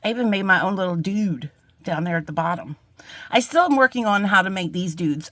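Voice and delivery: ordinary talk, nothing marked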